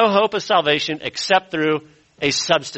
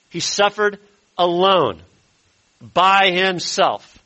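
slow stress; 3 semitones higher, slower than usual. Real, not acted. Stressing Christ suffered alone.

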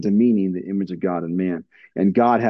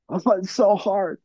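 put-on whiny kid; 9 semitones higher than usual. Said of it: "that's how they respond".

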